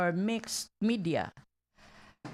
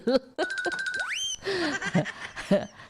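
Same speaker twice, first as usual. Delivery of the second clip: high giggle; 11 semitones higher than usual. Real, not acted. Laughing at own mispronunciation.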